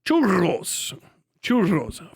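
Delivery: churros with a trilled R; churros, tongue trilling on the double R; rolled R. Shown as a demonstration. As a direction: roll the R in churros. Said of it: "Roll your R".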